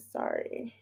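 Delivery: soft unvoiced apology; hushed sorry; whispered sorry. Real, not acted. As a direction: whisper it under breath.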